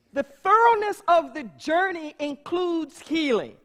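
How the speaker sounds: loud, slow, fervent preaching with long pauses; full-force preaching. Acted. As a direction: preach it to the back of the hall.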